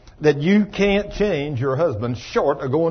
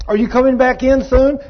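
higher, louder wife calling out; 8 semitones higher, 5 dB louder than usual. Retold or imitated. Wife calling husband back inside, higher and louder. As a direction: call him back inside.

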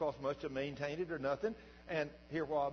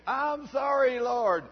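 higher, louder, slow pained cry; retold scream; 10 semitones higher, 12 dB louder, much slower than usual. Pastor with a burning eye cries out to God, screaming. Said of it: "And I started screaming".

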